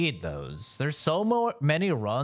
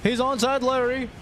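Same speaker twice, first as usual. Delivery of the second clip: raging gamer; 5 semitones higher than usual. Real, not acted. Frustrated fight, yelling.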